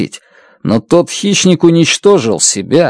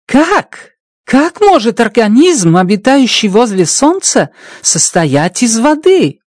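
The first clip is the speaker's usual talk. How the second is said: astonished and much higher, slowing at the end; 9 semitones higher than usual. Acted. Strat, stunned that a sun-dweller is made of water, astonished high. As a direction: disbelief pushing the voice up. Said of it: "раскрыл от изумления рот".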